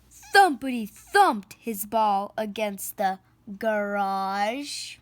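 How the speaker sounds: ordinary delivery, nothing marked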